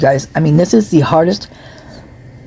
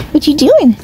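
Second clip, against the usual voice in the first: high cooing pet voice, much higher; talking to the cat; 11 semitones higher than usual. Real, not acted.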